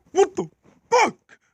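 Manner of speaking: high, loud angry yell, split by a pause; furious outburst. Acted. Gordon fuming over shunting his own coaches, furious yell. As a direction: yell it with a break before the curse. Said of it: "they were very fucking mad".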